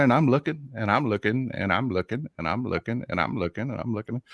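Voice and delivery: searching on and on, fading to a murmur. Retold or imitated. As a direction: let it trail away as you keep searching. Repeated searching, fading away.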